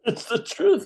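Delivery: high, slow, laughing repeat of "It's the truth". Real, not acted. Repeating line, then laughing.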